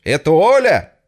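loud, high phone call shout; shout down the line. Acted. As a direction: yell to be heard. (кричит в плохую телефонную связь)

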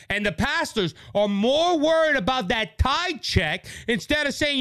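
normal talking voice

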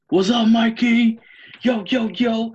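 high, slow, loud hype call; hype intro shout. Acted. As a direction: shout it like a show host pumping the crowd. Hyped podcast intro shout.

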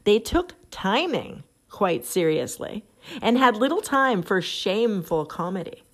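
ordinary talk

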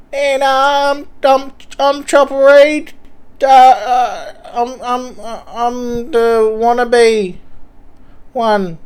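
high, thin tough-guy voice; a hard man gone squeaky; wannabe Chopper Reed. Pretend. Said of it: "Chopper Reed without balls".

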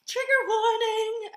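high, drawn-out sing-song; sing-song warning. Pretend. Echoing the co-host's trigger warning, high sing-song. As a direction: echo it playfully, very high and slow.

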